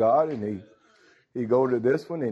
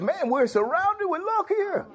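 alarmed servant. Retold or imitated. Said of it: "He said".

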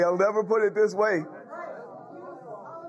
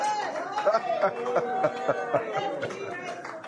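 joyful high laugh; 12 semitones higher, 4 dB quieter than usual. Real, not acted. Preacher laughs in joy.

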